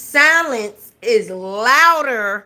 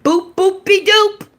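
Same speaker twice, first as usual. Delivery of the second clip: higher, sing-song scat noise; playful boopy doop; 7 semitones higher, 3 dB louder than usual. Acted. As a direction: toss it off like a silly jingle. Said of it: "That's how they say it".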